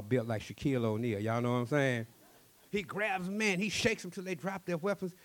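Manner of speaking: plain reading voice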